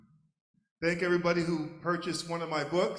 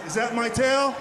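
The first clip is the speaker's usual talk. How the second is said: loud, higher indignant question; punchline shout; 6 semitones higher, 9 dB louder than usual. Acted. Dog sniffing another dog's backside, loud questioning shout. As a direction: call it out as the big punchline.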